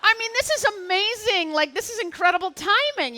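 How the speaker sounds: can't believe the luck; thrilled. Real, not acted. Telling the audience about the perfect timing, thrilled and loud. Telling of her lucky break.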